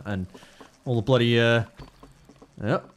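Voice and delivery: ordinary talk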